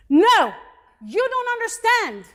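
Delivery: high, loud cry of no; retold yell. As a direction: yell it back as she did. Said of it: "she yelled at me".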